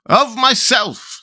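each one grabs the honour; councillors each claiming it. A narrator's reading. Councillors quarrelling over the statue, high slow echo. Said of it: "said each of the town councillors".

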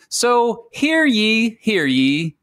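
slow, high crier call; mock royal decree. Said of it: "learn loudly and clearly".

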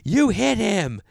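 high, loud repeat of the accusation; insistent accusation. Retold or imitated. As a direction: hammer the blame home this time. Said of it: "And she goes".

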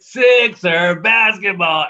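loud, high, drawn-out PA shout; arena announcer call. Retold or imitated. Announcer calls the turnover for the Sixers, big drawn-out shout. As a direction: imitate a hyped PA announcer, stretching each word. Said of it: "like, they turn it over".

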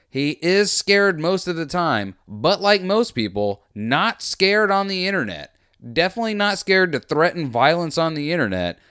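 high, loud sarcastic jab; mocking commentary. Real, not acted.

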